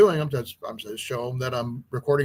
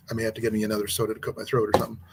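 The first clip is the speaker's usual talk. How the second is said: usual speaking voice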